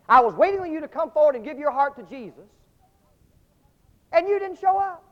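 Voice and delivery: loud, pleading reproach; rising to a near shout of disappointment; preacher's rebuke. Retold or imitated.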